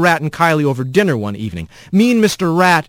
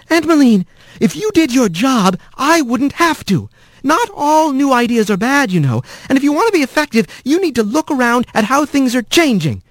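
higher, sharp but held-back anger; contained anger; 11 semitones higher than usual. A narrator's reading. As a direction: let the anger out in a tight, controlled burst. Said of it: "her anger bursting out now, more contained".

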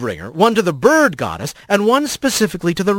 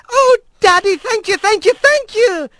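much higher, delighted squeal; squealing with joy. Acted. Young otter thanking her father for a first mate, squealing with joy. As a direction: gush like a thrilled young girl. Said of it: "gave a squeal".

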